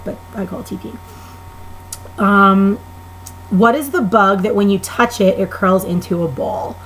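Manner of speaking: usual speaking voice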